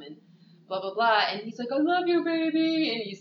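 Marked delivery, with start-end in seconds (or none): 1.7-2.9 high, sweet-talking croon; mock sappy plea. Friend mimicking Blue sweet-talking from the cop car. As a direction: croon it to win her back.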